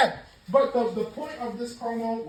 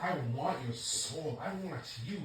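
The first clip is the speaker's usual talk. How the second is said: mock seductive Rock; 6 semitones lower, 12 dB quieter than usual. Vlogger mocks The Rock begging for Cody's soul, softly seductive. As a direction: creep it out like a would-be seducer. Imitating The Rock's creepy seduction.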